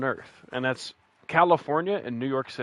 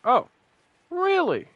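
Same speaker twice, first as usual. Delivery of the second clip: surprised; 5 dB louder, slower than usual. Real, not acted. Hearing New York gun laws.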